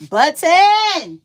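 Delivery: sudden high, loud yell on the last word; shouted button. Real, not acted. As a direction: belt out button. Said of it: "when I be yelling like that".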